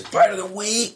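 high, announcer style segment call. Pretend. Announcing his favourite segment like a show host. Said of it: "One of my favorite segments".

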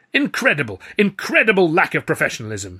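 loud, high, indignant splutter; spluttering outrage. A narrator's reading. Star berating a colleague, spluttering. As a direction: splutter it loudly in disgust. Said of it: "he spluttered".

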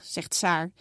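plain reading voice

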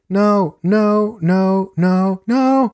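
high, loud repeated cries; acted high-pitched screams. A narrator's reading. Narrator voices victim under attack, acted high-pitched cries. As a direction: cry each word out high and loud. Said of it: "repeated high-pitched screams".

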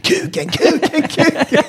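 high imitated tic outbursts; mock tic shouting. Retelling a customer with tics, mock shouting. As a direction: blurt them like a tic. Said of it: "satt vid bordet och skrek".

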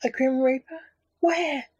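high, startled gasp of horror; horrified. A narrator's reading. Ghost panics at hearing of a reaper, high and horrified. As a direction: leap up in pitch, frightened. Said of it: "asked the ghost in horror".

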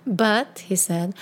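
normal talking voice

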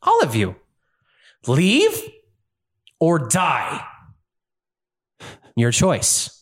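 furious villain. Acted. Villain ordering the whole party, furious. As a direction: lay down the law to all of them. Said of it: "he's like".

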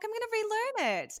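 mock determined promise, high-pitched; mock resolve. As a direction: quote her past self in a high, chirpy voice. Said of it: "I'm like".

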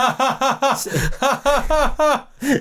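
high, rising burst of real laughter; laughing hard at the memory; big laugh. Real, not acted. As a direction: let a big laugh break out. Laughing at broken shelf story.

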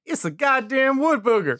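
high-pitched cry of discovery; startled exclamation. Acted. Camper spots a wood booger, startled exclamation.

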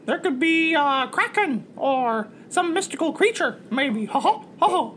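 high, polite mascot voice; cartoon mouse first mate. First mate reports dark clouds to captain, high cartoon mouse. Plays Mickey the rat.

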